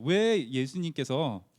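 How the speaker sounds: normal talking voice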